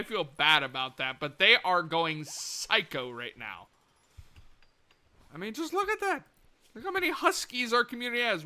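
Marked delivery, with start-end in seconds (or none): 5.3-8.4 excited. Real, not acted. Still delighted by huskies.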